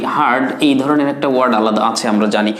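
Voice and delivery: ordinary talk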